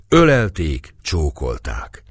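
ordinary delivery, nothing marked

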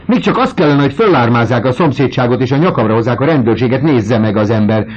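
loud, high, fast scolding; indignant tirade. A narrator's reading. The landlady scolding about the neighbours and police. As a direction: rattle off the complaint in a fluster.

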